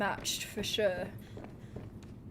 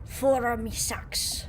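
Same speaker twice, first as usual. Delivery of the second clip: put-on pirate accent; slower than usual. Pirate talk, slow.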